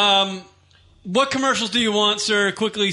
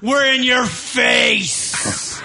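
wrestling promo; 5 semitones higher than usual. Acted. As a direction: hype the crowd, big and slow. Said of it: "let's do a promo".

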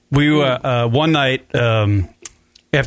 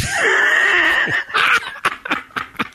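high laugh. Real, not acted. Laughing at the story.

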